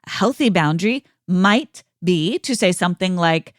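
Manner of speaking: ordinary talk, nothing marked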